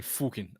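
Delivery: "fucking" said as "fooking"; "fucking" in a Scottish way, first vowel as oo; Scottish-style swearing. Shown as a demonstration. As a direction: relish "fucking" with an oo vowel, soft and low. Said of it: "I just like saying".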